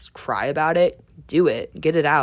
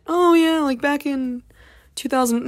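mocking; 10 semitones higher, 3 dB louder than usual. Mocking a self-centred listener.

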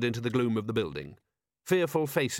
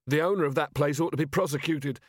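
mildly raised, higher indignant voice; indignant shout; 4 semitones higher than usual. A narrator's reading. Robber leader complaining to the captain, mildly shouting. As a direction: protest angrily, held short of full shouting. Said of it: "he shouted".

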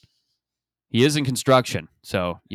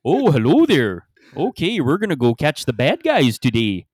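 hammed-up Canadian Mountie; 5 semitones higher, 5 dB louder than usual. Acting Canadian movie cop.

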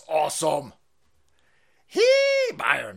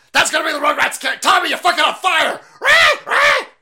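high loud screaming rant; mock screaming teacher; 13 semitones higher, 10 dB louder than usual. Mock teacher screaming doom at the children. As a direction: scream it at the class.

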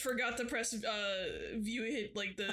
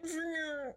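higher whirring plane sound; imitated plane noise; 6 semitones higher than usual. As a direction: mimic a plane's engine whine with your voice. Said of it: "kinda like a plane".

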